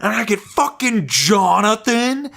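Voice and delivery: high-pitched indignant tirade; hammed-up, incredulous tantrum in a high voice; mock outraged rant. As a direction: imitate a friend going off about being cheated. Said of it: "he went off".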